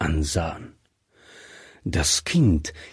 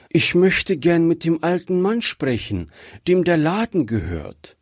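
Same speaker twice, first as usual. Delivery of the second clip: put-on higher, childlike voice; little girl; 7 semitones higher than usual. A narrator's reading. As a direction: voice a polite little girl asking for someone. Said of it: "sagte das Kind". (hohe Stimme eines kleinen Mädchens)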